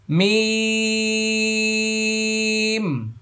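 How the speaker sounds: মিম held very long and high, lips shut at both ends; slow tajweed demo of মিম; letter name drawn out. Shown as a demonstration. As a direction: hum মিম out slowly. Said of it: "শুরুতেও ঠোঁট লাগবে".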